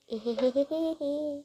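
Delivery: light, rapid giggle; quick tittering laugh. Real, not acted. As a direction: giggle in a quick string of short bursts. Giggles to self while playing.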